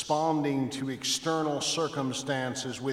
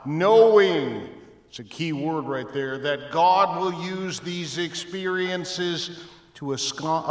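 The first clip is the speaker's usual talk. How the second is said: ordinary delivery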